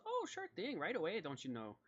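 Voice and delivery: very high put-on lady's voice, easing down; eager lady helper. Judy agreeing to clean up, high-voiced and eager. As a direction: pipe up in falsetto, then drop back. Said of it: "And she goes".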